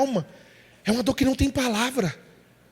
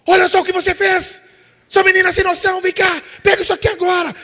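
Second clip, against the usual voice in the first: loud, higher furious yelling at a daughter; angry shout; 6 semitones higher, 7 dB louder than usual. Retold or imitated. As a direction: scream at her as the raging father. Pai gritando com a filha. (pai gritando furioso com a filha, voz alta e aguda)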